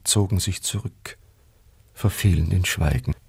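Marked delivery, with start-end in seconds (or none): none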